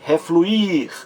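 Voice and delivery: "refluir" with the last I drawn out high; stress on final I. Shown as a demonstration. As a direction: lean hard and long on the IR of "refluir". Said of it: "mais forte". ("refluir" com o I final forte e alongado)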